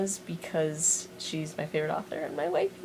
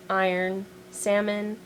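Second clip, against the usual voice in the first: normal talking voice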